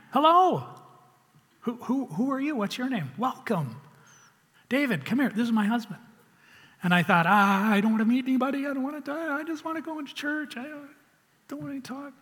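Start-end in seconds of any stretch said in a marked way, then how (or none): 0.1-6.0 high-pitched gushing greeter; eager welcome. Retold or imitated. Church greeter welcomes a nervous newcomer, eagerly. 7.2-12.1 mock panic. Shy newcomer dreads meeting people at church, in mock panic. Retells his inner panic at greeting.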